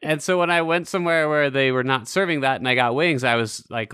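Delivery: normal talking voice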